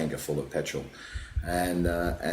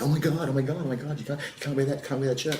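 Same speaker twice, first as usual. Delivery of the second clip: high, hushed panicky protest; freaking out; 7 semitones higher than usual. Retold or imitated. Events organiser spotting the offending T-shirt, imitated high panicky fluster. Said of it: "this woman freaks out".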